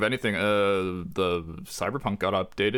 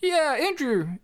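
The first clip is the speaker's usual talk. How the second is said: squeaky voice; much slower than usual. Acted. High put-on voice.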